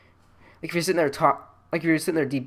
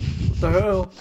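raised, louder shocked exclamation; mock disbelief; 5 semitones higher, 5 dB louder, slower than usual. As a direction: act out a startled reaction. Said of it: "It's like".

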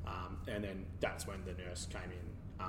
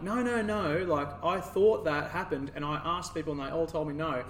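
much higher and louder, pleading with outrage; furious denial; 6 semitones higher, 7 dB louder than usual. Retold or imitated. To the panicking nurse, denying any nerve damage: furious high protest. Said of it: "I was seriously angry".

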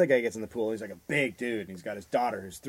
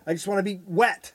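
put-on higher, pleading toddler; mock whiny kid; 8 semitones higher, 3 dB louder than usual. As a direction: imitate the child wanting the water. Voicing Finn's wish.

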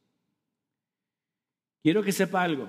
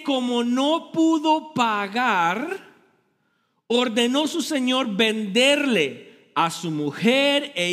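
raised, forceful preaching voice; 3 semitones higher than usual. Acted. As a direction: drive the parable home to the congregation.

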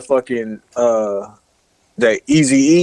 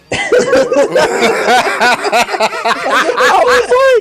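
long high laugh; 13 semitones higher, 3 dB louder than usual. Real, not acted. Friend cracks up at a lyric's silliness, high laughing. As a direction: burst out in high, loud-edged laughter.

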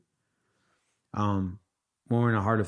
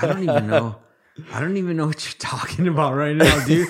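mock bewildered; 8 semitones higher, 9 dB louder than usual. Mock exasperation at Nick, laughing.

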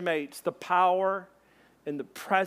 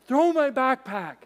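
raised, higher voice; calling across; 7 semitones higher, 5 dB louder than usual. Acted. Friend on the bank calling to the truck, calling out. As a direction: call it over a distance.

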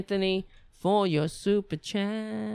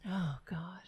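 exasperated breathy whisper under the breath; whispered oh god; 10 dB quieter than usual. Real, not acted. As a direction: sigh it out in a whisper. Exasperated aside.

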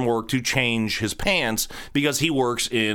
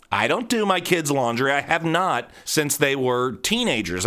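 ordinary delivery

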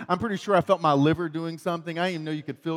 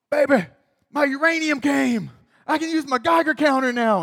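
high, loud, eager announcement; excited to wife; 9 semitones higher, 6 dB louder than usual. Retold or imitated. Telling his wife the uranium came, high eager excitement. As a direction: burst in thrilled with the news. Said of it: "and I'm like".

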